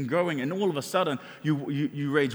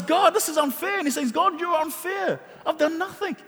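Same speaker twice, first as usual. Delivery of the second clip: high, pleading complaint to God; a high, hurt protest that God is unfair; complaining Job; 10 semitones higher than usual. Acted. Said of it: "And he starts complaining".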